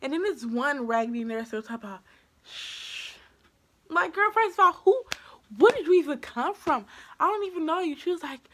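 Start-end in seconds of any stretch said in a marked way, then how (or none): 2.5-3.2 long, soft "shh"; drawn-out hushing noise; imitated shushing. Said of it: "Don't scream". 3.9-8.0 loud, high-pitched outrage; raised voice, affronted and sassy; indignant retort. Retold or imitated. Indignant retort to the shushing nurse.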